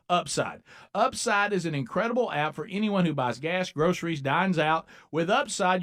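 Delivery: upbeat radio ad read. Acted. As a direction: sell it like a host-read spot. Host reads a sponsor ad.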